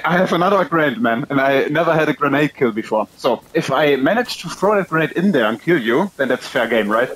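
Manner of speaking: ordinary talk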